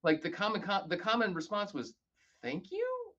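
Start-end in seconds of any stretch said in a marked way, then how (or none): 2.4-3.1 "Thank you?" with "you" jumping high like a puzzled question; confused "Thank you?", pitch leaping up on "you"; upward inflection. Retold or imitated. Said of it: "with an upward inflection".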